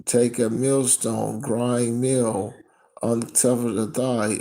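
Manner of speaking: loud, slow preaching read; scripture reading. Acted. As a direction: proclaim the verse with force. Reads scripture loudly.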